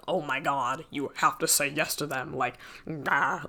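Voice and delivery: mild mock gush, urging her friend; excited Janice. Janice urging Katie to accept, lightly voiced excited gush.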